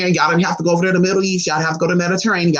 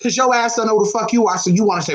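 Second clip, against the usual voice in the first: normal talking voice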